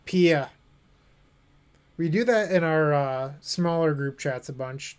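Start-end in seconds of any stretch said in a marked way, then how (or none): 0.1-0.5 put-on "Pia" in a Boston accent, r dropped, said a bit louder; Boston "Pia" with no r at the end, like "pee-ah". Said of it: "that's how they say it in Mass".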